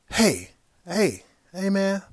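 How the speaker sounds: loud, high call across the parking lot. Retold or imitated. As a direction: shout after him to turn around. Calling after him across lot.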